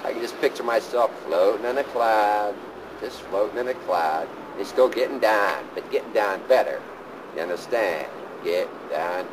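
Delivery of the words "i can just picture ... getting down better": higher, lifted dreaming voice; picture heaven with delight; raised wistful fantasy; dreamy excitement. Real, not acted.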